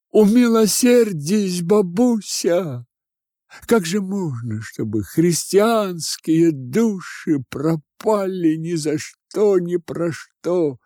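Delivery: high, drawn-out pleading; imploring. Acted. Students imploring the old woman for shelter, high slow entreaty. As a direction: beg for mercy, stretching the words.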